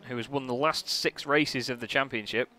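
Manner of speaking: normal talking voice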